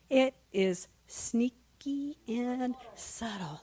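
drawn-out, stealthy delivery; sneaky and subtle. Acted. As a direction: creep through the words, stretching each one. Drawing out the sneaky attack.

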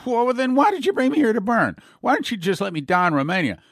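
high, loud cry of protest; a desperate man shouting his complaint to God; anguished protest. Retold or imitated. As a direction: hurl the question at heaven in despair. Dimitri protests to the angel.

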